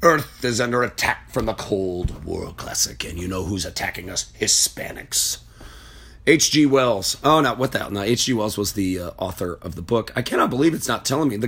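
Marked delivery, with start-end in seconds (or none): 0.0-5.4 lower, softer mock old-radio announcer voice, whispering the last word; a hammed-up 1950s broadcast voice imitating Welles; Orson Welles impression. Host imitating the War of the Worlds director, lightly hammed-up. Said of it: "and he talked like this".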